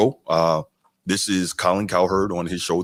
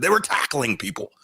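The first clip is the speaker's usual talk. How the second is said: higher mock quote of Cowherd; 7 semitones higher than usual. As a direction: parrot his line in a lifted mocking voice. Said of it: "That's how he says it".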